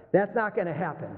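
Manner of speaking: normal talking voice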